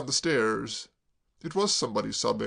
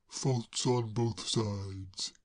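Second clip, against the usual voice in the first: very slow, softer, magnanimous; magnanimously; 4 semitones lower, 4 dB quieter, much slower than usual. Acted. Braddock forgiving Claire, magnanimous. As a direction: grant it generously, slowly. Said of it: "said Mister Braddock magnanimously".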